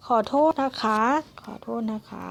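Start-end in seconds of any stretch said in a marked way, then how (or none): 1.4-2.3 said again much softer, almost to herself; teacher quietly repeating the polite apology for the class. Shown as a demonstration. Teacher repeats the apology softly to students.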